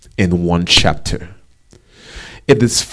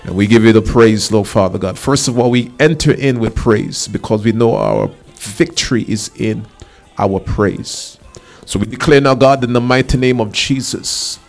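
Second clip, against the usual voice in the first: declaring prayer, commanding and insistent; fervent prayer; 3 semitones higher than usual. Real, not acted. Preacher leads the congregation praying against child abductions, fervent prayer. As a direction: pray with authority, key words stretched out.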